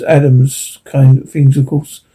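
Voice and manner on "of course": plain reading voice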